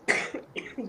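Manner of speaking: sudden dry cough mid-answer; coughing fit. Real, not acted. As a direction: cough to clear the throat. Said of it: "just got a catch in my throat".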